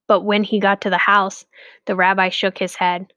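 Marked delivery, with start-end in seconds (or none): none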